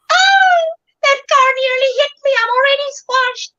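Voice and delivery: high, fast cartoon voice of a panicking can; animated Coca-Cola can. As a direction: squeal it out like a squashed little can. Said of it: "talking in an animated voice, like I'm the Coca-Cola can".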